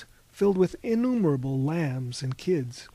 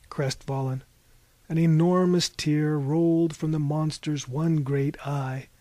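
plain reading voice

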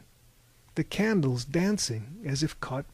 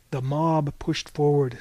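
plain reading voice

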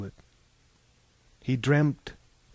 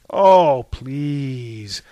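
exasperated scoff; 8 dB louder than usual. A narrator's reading. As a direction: cut in with exasperated disbelief. Scornful interruption of the author's narration.

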